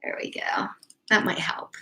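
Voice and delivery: soft whispered aside while fixing the camera. Real, not acted. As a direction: whisper it under your breath.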